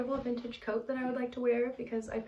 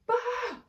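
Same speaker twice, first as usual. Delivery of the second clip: mock wail; 4 dB louder than usual. Mock distress cry.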